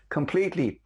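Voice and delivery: ordinary talk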